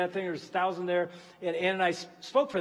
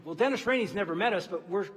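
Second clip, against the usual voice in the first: normal talking voice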